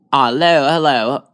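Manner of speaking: ordinary delivery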